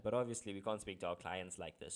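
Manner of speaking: ordinary delivery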